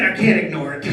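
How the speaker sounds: ordinary talk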